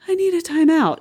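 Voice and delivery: imitating the version that never happens; sulky time-out demand. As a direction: whine it a little, half-joking. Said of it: "And it's never like".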